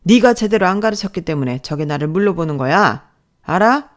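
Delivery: loud, angry scolding; yelling. Acted. Boss blaming the trainer, yelling. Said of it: "소리를 질렀어요".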